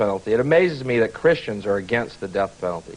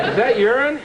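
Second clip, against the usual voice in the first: higher, excited voice of a guy sniffing the streets; mock amazed New Yorker; 8 semitones higher, slower than usual. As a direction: play a thrilled New Yorker proud of his nose, laughing through it. Acting out a New Yorker's discovery.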